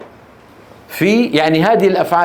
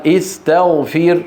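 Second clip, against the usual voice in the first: slow demo; much slower than usual. Shown as a demonstration. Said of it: "slow demo of the word".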